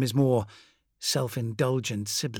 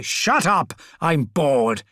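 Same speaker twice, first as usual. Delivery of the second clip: angry outburst; 3 semitones higher, 6 dB louder, much slower than usual. Acted. Fulgrim cuts him off.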